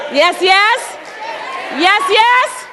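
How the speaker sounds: high, loud call for the crowd to answer; rally them with a ringing yes; excited. Real, not acted. Speaker hyping the audience to shout back, high and loud.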